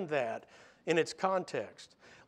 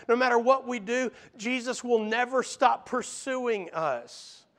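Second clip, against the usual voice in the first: insistent, rhythmic sermon build; fervent preacher in full flow; impassioned preaching; 4 semitones higher, 4 dB louder than usual. Acted. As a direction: drive each repeated phrase home from the pulpit.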